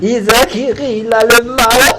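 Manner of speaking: words through laughter; laughing. Real, not acted. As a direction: laugh while mocking him. Laughing at Isaac. (en riant)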